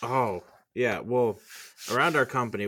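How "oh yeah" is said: ordinary talk, nothing marked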